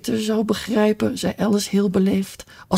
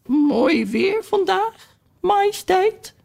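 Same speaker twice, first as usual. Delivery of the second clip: high, slow and timid greeting; much slower than usual. A narrator's reading. Duchess greeting the queen, in a very high timid voice. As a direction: squeak it out, scared and polite. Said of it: "met hele hoge stem".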